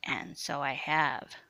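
slow, low sinister rasp; coarse, menacing voice. A narrator's reading. Coarse-voiced Sue answers menacingly.

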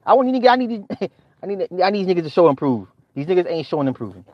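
ordinary delivery

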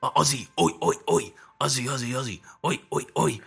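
hammed-up Aussie catchphrases; attempted Australian accent. Shown as a demonstration. Friend searching for Australian phrases, playful mock Australian accent. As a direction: fumble through stock Australian phrases and chants. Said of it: "what an Australian person sounds like".

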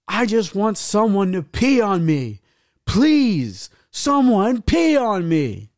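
high, drawn-out mock plea; imitated desperate yell. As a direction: yell it like a girl begging the whole room. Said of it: "you just hear her yell".

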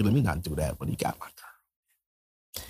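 low unvoiced whisper; whispered aside. Real, not acted. Comedian whispering about the rapper who got his girl, hushed. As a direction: whisper it like a secret grudge.

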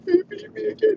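hammed-up tearful phone call; mock crying Gisele. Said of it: "She calls up Edelman crying".

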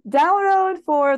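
"Download for" drawn out slowly with loud English flair; showy English. Retold or imitated. Imitating their English promo read, slow and showy. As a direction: stretch it like a fancy ad voice. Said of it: "発音よく言うように".

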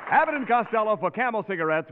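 usual speaking voice

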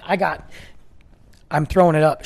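ordinary delivery

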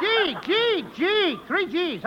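building up to a sneeze; pre-sneeze stammer. Acted. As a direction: stutter into a sneeze. About to sneeze.

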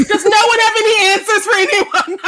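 loud, high, laughing exasperation; mock exasperation. Viewer fed up with the plot, laughing exasperation. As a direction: throw up a laughing, exasperated plea.

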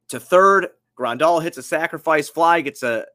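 ordinary talk

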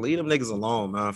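usual speaking voice

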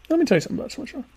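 high hammed-up pundit voice announcing a lecture; pompous pundit. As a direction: ham up the self-important expert taking the floor. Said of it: "I don't really talk like that".

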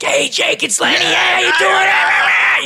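high, loud, drawn-out shouted greeting; a buddy yelling a big hello from down the beach; Lenny Clark impression. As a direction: holler across the beach like an excited old friend. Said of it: "always come up to me on the beach".

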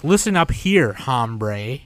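put-on Spanish word in a heavy English accent; mock gringo saying hombre. Mocking English speakers saying Spanish words, thick English accent. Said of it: "super huge English accent".

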